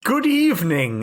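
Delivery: normal talking voice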